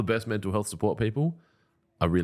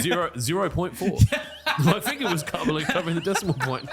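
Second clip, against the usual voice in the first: higher voice breaking into laughter; laughing while talking; 7 semitones higher than usual. Real, not acted. Host mocking co-host's viewer count, laughing through it. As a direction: barely get the joke out for laughing. Joking about tiny audience figure.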